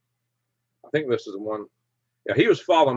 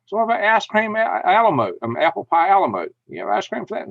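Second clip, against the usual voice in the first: pressing friend; 8 semitones higher, 3 dB louder than usual. Retold or imitated. Ronnie keeps pressing the waitress.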